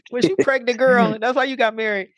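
playful high-pitched mock question to mom. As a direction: tease her like a gossiping friend. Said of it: "I'm gonna call after this".

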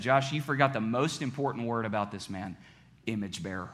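lightly voiced quiet inner prompting, dropping to a hush; Holy Spirit's voice. Holy Spirit interrupts preacher's thoughts, gently correcting him. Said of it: "the Holy Spirit interrupted my thoughts and said".

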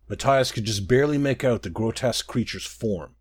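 ordinary delivery

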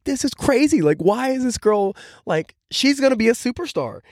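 high-pitched disbelief, retold; amazed reaction. Acting out own amazed reaction.

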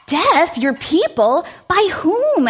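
king shocked by Esther's news; surprised. Acted. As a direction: demand it in disbelief. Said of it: "asked the surprised king".

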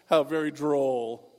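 slow, loud, pompous drawl; snooty snob. Acted. Rich kid dismissing the cabin trip, slow and snooty. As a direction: draw it out with lofty disdain. Snobs' dismissive reply to Scott.

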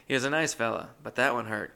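ordinary talk, nothing marked

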